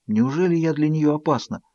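ordinary delivery, nothing marked

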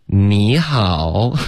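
drawn-out, connected greeting; slow linked demo. Shown as a demonstration. Teacher showing slow speech still links, slow joined greeting. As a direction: stretch both syllables without a break between them. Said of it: "然后他说得多慢".

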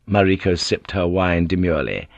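ordinary delivery

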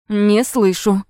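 sharp, demanding taunt; stern demand. A narrator's reading. Attacker demands a louder answer.

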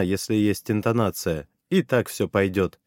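ordinary delivery, nothing marked

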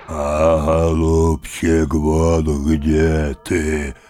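slow, drawn-out growly roar; roaring bear. Acted. Bear behind the tree mocking grandfather, slow roar. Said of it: "заревел медведь".

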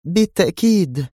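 high female voice, uncomprehending; vague agreement. Acted. Zosha vaguely agreeing with Yuri, high voice. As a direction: agree without understanding. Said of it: "غمغمت دون أن تستوعب".

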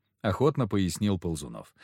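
ordinary delivery

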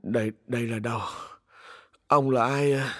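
just-woken, thick-tongued murmur; slurred, groggy. A narrator's reading. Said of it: "Mạnh cất giọng nhờ nhựa". (giọng nhờ nhựa, ngái ngủ)

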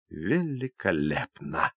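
slow, low, hushed whisper; drawn-out, softly whispered approval. A narrator's reading. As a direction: whisper it slowly, savouring the word. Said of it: "прошептала Обливия".

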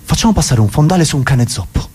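lightly played director, trying another pitch; a touch of eager theatre director; director with an idea. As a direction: propose it as a fresh brainwave. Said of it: "uno dice all'altro".

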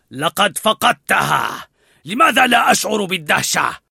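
a leader mocking his failed dog; loud, contemptuous; scornful pack leader. A narrator's reading. Narrator voices Alpha.

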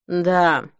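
dull, resigned reply; unenthusiastic. A narrator's reading. Sharon answering Denise from the waiting chairs, unenthusiastic. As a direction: answer with no enthusiasm at all. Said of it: "без всякого энтузиазма откликнулась Шерон".

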